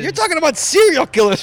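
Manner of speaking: high-pitched, exasperated protest; exasperated outburst. Real, not acted. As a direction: cut in, incredulous and fed up. Exasperated co-host interrupts the story.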